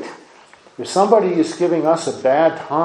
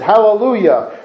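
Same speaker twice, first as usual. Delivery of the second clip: full-voiced hallelujah; loud praise; 5 semitones higher, 4 dB louder than usual. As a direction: ring out the hallelujah, louder. Second hallelujah in reading.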